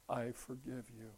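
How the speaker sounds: slow, self-satisfied, lordly pardon; smug forgiveness. Retold or imitated. Speaker to friend, condescending smug pardon. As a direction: grant it like you're doing them a favor. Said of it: "As if I'm really wonderful".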